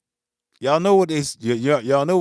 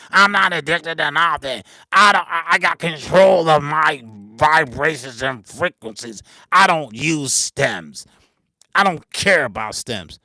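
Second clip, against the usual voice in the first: mocking a boastful producer; 9 dB louder than usual. Producer mocks other beatmakers, loud sneering mock brag. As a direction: ham up a know-it-all denying everything.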